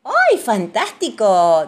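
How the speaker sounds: loud, drawn-out exclamation; big slow jovial approval; delighted praise. A narrator's reading. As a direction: cheer the kids on, stretching the words. Papá Pig praises the children.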